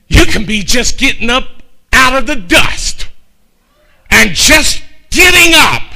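preaching that swells to a shout; building preaching. Acted. Pastor building to the congregation, swelling preaching. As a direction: rise from talking to preaching.

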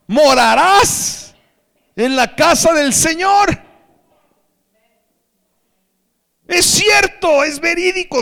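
slow, high, booming preacher's shout; full-force pulpit cry of certainty; impassioned proclamation. Real, not acted. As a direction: shout it out, stretching each word.

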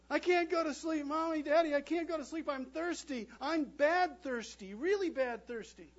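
put-on high, loud whiny child pleading. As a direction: beg like a sleepless toddler.

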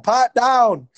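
high, exclaimed horse voice; talking horse. Retold or imitated. Horse cuts into the soldiers' fight, mock exclamation. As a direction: call it out as an exasperated horse. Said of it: "the horse is like".

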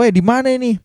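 high, urgent retold "where are we"; mock-bewildered, pitched well up; startled question. Narrator to his friend on waking in the car, bewildered question.

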